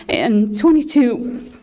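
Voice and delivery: choking up while thanking the doctor for his kindness; real emotion breaking through a formal thank-you; getting emotional. Real, not acted. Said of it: "I'm sorry I'm getting emotional".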